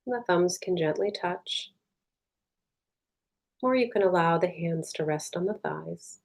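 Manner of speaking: soft, unhurried meditation voice with long pauses; guided meditation. Real, not acted. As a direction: guide them gently inward, leaving space between phrases.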